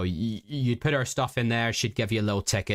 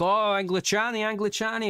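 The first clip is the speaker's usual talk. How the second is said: much higher, thrilled repeat of the word; excited taxi driver; 11 semitones higher than usual. Retold or imitated. Old taxi driver meeting English passengers, calling out in high delight. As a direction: squeal it out like a delighted old cabbie. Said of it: "he got really excited".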